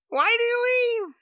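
mock exasperated cry. Asking the villain why he left, mock exasperated cry. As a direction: wail the question, stretched out. Acting out reaction to quick return.

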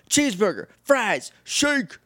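high, punchy list, rising in excitement; eager mock ordering. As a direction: imitate an excited kid calling out an order. Voices Danny eagerly ordering junk food.

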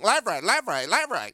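stiff robotic game voice. Retold or imitated. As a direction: call directions like a machine. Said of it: "the robot voices are overlapping".

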